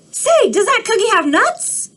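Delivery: high, quick cartoon pigeon demanding answers; a nosy, pushy bird; squawky pigeon grilling the duckling.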